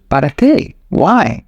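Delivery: a hint of a farmer questioning it all; puzzled goat farmer. Farmer answering the pushy tourist, mildly puzzled. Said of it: "And the goat farmer says".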